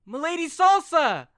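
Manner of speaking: high, loud delighted call; kid's excited cry. Acted. Kid Crow spotting his counselor, a high loud joyful call. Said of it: "he's like".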